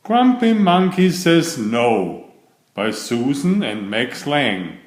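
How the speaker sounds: plain reading voice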